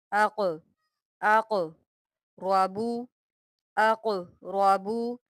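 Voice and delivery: अक़ with a bounced, echoing क़ before रबु; qalqalah on qaf. Shown as a demonstration. Teacher drills students on qaf's qalqalah. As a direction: bounce the क़ in अक़रबु. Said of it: "क़ाफ यहाँ पर हम कलकला करेंगे".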